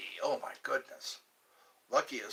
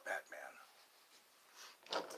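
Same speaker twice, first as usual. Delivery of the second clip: whispered; 12 semitones lower, 15 dB quieter than usual. Pretend. Whispered Batman punchline.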